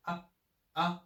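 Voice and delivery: ah very short and slight; just a quick light ah; short neutral tone. Shown as a demonstration. Teacher showing the toneless short syllable. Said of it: "like slight but very short breath".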